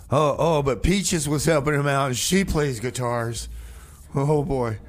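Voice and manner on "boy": ordinary talk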